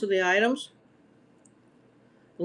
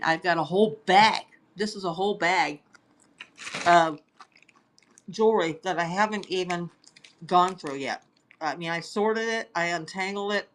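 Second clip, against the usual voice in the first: normal talking voice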